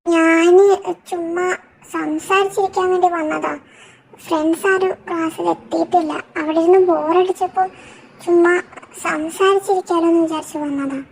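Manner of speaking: put-on high-pitched girlish voice, a little plaintive; timid girl character. Ami explaining why she came to chat, high plaintive girl voice. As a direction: answer in a small, high girl's voice.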